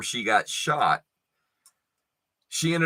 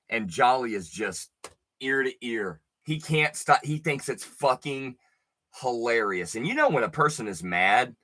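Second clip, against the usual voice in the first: normal talking voice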